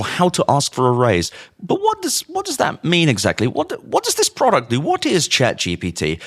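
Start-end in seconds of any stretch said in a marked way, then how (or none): 1.6-6.2 high, incredulous stammered questions; baffled, pitch leaping up; exasperated questioning. Real, not acted. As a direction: restart the question in rising exasperation.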